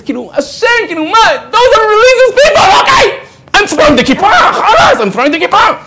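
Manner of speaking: high, loud outburst broken by laughter; mock outraged yell. Client who lost the villa yelling about the religious lawyer, laughing outrage.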